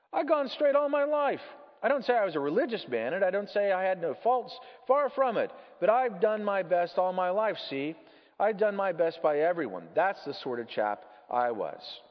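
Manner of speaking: high, loud, blustering self-defence; self-righteous ghost. Acted. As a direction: protest your decency with force.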